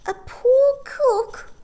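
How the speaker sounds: very high, slow, loud question; pry curiously; inquiring old woman. A narrator's reading. Old shopkeeper asking about the cook. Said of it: "inquired Missus Corey".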